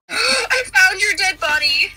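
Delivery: shocked and high. Real, not acted. Shocked discovery.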